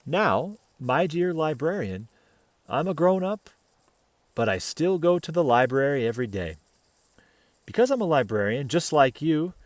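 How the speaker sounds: put-on gentle read-aloud voice for children; picture book reading to kids; storybook narration. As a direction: tell it like a bedtime story.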